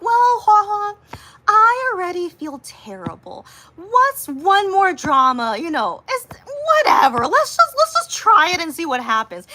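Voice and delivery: telling the friend she'll watch anyway, hammed-up; dramatic defiance. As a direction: throw caution to the wind. Defiant retold reply to friend.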